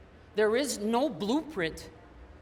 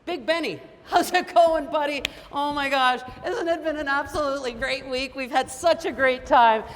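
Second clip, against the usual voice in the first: excited greeting; 4 semitones higher, 5 dB louder than usual. Retold or imitated.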